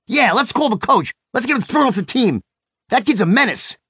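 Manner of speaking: put-on high-pitched, riled-up kid agreeing; imitated angry son. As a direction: join in Dad's outrage, pitched high. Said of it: "He became just as angry".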